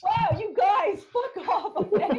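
loud, high-pitched protest at friends; laughing outburst. Real, not acted. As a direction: shout it high, half laughing at their nerve. Outburst at heckling friends over slur.

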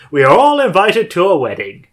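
hammy segue announcement. Pretend. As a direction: announce it with playful pomp.

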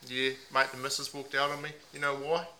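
a lightly put-on Aussie bloke accent, mate on every line. Host playing a heartbroken meth packer in a mild put-on Aussie accent. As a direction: ham up the ocker Aussie, leaning on mate. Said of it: "put on a few different voices".

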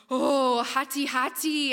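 loud, drawn-out mock alarm; wide-eyed alarm. Indonesian fishermen echo the danger word, slow mock alarm. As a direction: imitate awed fishermen, stretching the warning slowly. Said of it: "and their eyes are wide".

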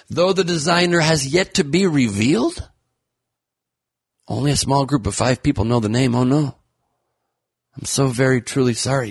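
high, gushing gossip voice; mock excited gossip. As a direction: gush like a tabloid host.

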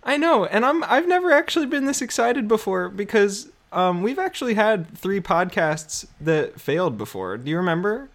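put-on high, eager young voice; younger self voice. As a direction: play your younger, hopeful self. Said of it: "We're so young and optimistic".

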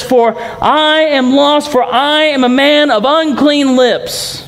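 high, slow, pleading prophet's cry; Isaiah's cry. Acted. Preacher voicing Isaiah before God, high and slow. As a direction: lift the voice high and slow, like a man undone.

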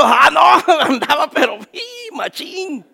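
a hint of a laughing high youth; giddy high youth. Young man recalling the drug high, giddy and laughing. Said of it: "¿qué pasó?".

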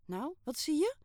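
high, loud eager question; can't wait for him to see it; excited. Acted. Girl pushing Kayale to look at her photo, eager and loud. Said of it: "vroeg ik opgewonden".

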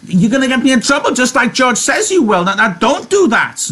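very high, stammering protest; agitated anger. Acted. Lenny fearing trouble with George, angry and agitated. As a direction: build to a high, panicked scolding. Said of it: "Then Lenny grew angry".

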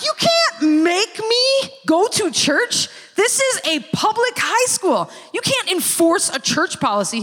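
high, drawn-out mock outrage; indignant protest. Player arguing with coach about church, indignant. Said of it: "I'm yelling".

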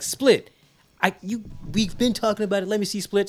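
mock excited request; girlfriend voice.

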